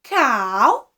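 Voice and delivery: ordinary talk, nothing marked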